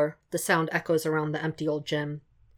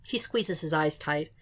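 ordinary delivery, nothing marked

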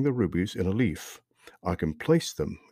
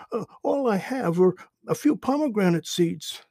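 high, scared stammer; afraid; 11 semitones higher than usual. Acted. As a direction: plead nervously, hands raised. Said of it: "Akim became afraid".

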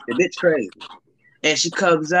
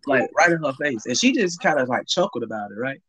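normal talking voice

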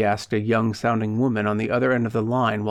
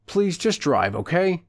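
exasperated; 6 semitones higher than usual. Acted. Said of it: "he urged in an exasperated tone".

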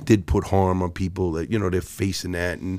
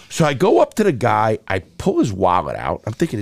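ordinary talk, nothing marked

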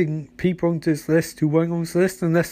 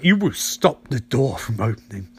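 solemn command voice; 7 semitones lower than usual. Retold or imitated. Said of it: "So it's like".

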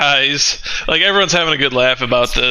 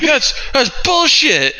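higher and slower, outraged complaint; a whiny mock objection pitched up; indignant protest; 11 semitones higher than usual. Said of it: "is like".

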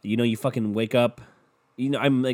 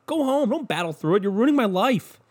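much higher, strained, fed-up yell; a high-pitched mock tirade at a sick coworker; exasperated outburst; 13 semitones higher than usual. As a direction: snap at the coughing coworker to go home. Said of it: "And you're like".

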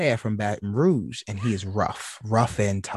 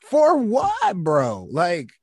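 exasperated rant; 4 semitones higher, 5 dB louder, much slower than usual. Real, not acted. Ranting at arguing men.

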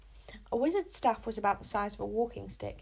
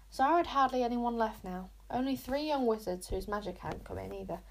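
ordinary delivery

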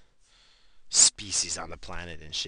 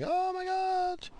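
much higher, drawn-out mock shriek; mock panic; slower than usual. Imagining the alien mothership arriving, mock panic shriek. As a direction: squeal like the mothership just appeared.

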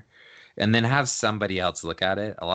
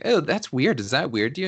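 put-on valley girl voice; 8 semitones higher, 6 dB louder than usual. Someone else critiques, high voice.

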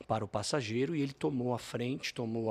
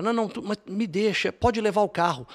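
high, louder anxious pleading; 8 semitones higher, 8 dB louder than usual. Retold or imitated. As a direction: offer the car in a panicky rush. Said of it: "cheguei a falar".

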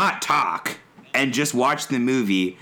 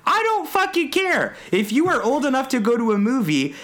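ranting fury; 9 semitones higher than usual. Retold or imitated. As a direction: yell it like the rules are sacred. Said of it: "And I'm like".

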